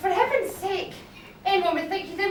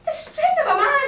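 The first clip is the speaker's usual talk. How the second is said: surprised; 8 semitones higher, 5 dB louder than usual. Acted. Maid cries out at her grip.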